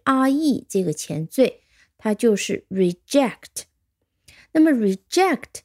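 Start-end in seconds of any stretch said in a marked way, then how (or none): none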